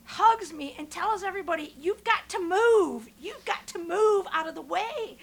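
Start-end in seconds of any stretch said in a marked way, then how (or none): 1.8-5.2 big, drawn-out order to the whole bus; loud command. Retold or imitated. Shuttle driver tells everybody on the bus, loud command. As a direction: act out clearing the crowd, loud and higher.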